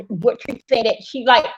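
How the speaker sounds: ordinary talk, nothing marked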